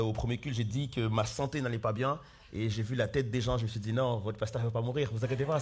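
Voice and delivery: usual speaking voice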